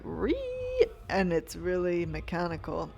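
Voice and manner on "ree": much higher squeal like a power drill; imitated drill whine. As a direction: squeal it out like a drill biting into the wall. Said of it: "it makes the sound like".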